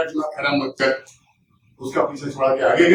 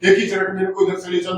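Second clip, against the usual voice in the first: ordinary delivery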